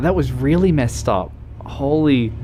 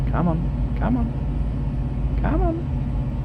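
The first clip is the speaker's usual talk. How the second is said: rising higher and higher cheer; urging on; 13 semitones higher, slower than usual. Real, not acted. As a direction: coax it on, climbing up in pitch. Urging the moving vehicle on.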